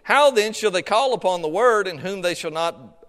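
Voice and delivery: ordinary talk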